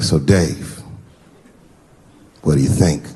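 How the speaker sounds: low-pitched imitation of a buddy; buddy's voice. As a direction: put the question in a deep, measured voice. Voices his buddy asking for opinion.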